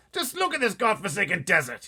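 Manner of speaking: blustering officer exploding; shouting Air Force chief. Acted. Air Force head shouting at the pilot, outraged. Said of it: "he cried".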